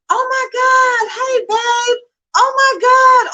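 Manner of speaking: high, loud tearful shriek of joy; a real joyful outcry, voice cracking high; crying out with joy. Real, not acted. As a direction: scream it out through happy tears. Overjoyed news on live stream.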